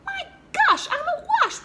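a very high, loud burst of glee; excited. Acted. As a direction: shriek with the thrill of a new idea. Frog gets a sudden idea.

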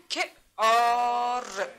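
کار slowly sounded out as که, long آر; sounding out. Shown as a demonstration. Teacher sounding out کار for pupils, stretched and separated. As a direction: hold the vowel long in که آر.

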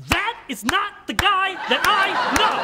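high, loud sing-song cheer; rally him with big enthusiasm; rousing pep talk. Acted.

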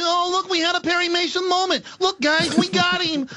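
high cartoon rabbit voice; squeaky cartoon bunny; Bugs Bunny voice. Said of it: "it's Bugs Bunny here".

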